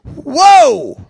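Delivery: loud, high gasp of wonder; astonished whoa. Retold or imitated. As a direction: gasp at what you see. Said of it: "I'm going".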